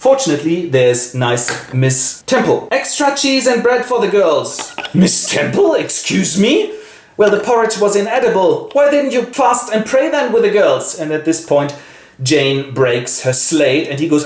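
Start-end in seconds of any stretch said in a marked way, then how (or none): 2.7-10.8 high voiced argument. Teacher and headmaster argue.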